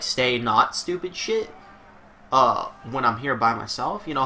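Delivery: ordinary delivery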